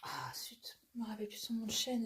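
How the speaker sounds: fast whisper to herself; muttered whisper, words rushing out; whispered aside. Real, not acted. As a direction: whisper quickly, annoyed at forgetting. Forgetting a name, aside.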